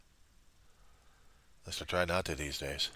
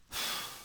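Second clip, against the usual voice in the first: soft weary sigh; 8 dB quieter than usual. Real, not acted. Speaker to Reverend with too much on his mind, soft sigh. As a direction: let out a breath. Pauses, weary, before apologizing.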